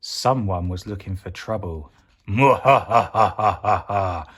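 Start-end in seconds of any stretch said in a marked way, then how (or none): 2.3-4.3 slow, loud villain cackle; evil cackle. Acted. Evil pea gloating over the supermarket, slow cackle.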